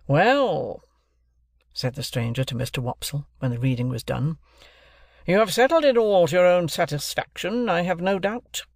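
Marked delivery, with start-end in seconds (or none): 0.1-0.8 a raised, scornful "well"; contemptuous stranger. Acted. 5.3-8.6 louder, higher sneering remark; mock the reader's certainty; sarcastic stranger. Stranger mocks Wopsle.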